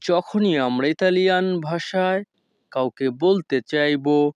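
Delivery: usual speaking voice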